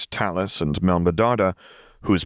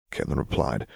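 plain reading voice

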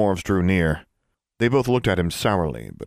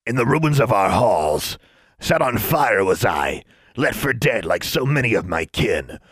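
dwarf voice; 4 semitones higher, 3 dB louder than usual. A narrator's reading. Dwarf lamenting.

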